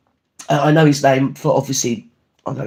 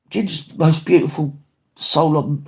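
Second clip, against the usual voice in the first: ordinary talk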